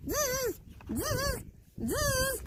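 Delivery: very high buzzing zzz; monkey chatter. A narrator's reading. Monkeys copying the peddler with high buzzing. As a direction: buzz back like mocking monkeys.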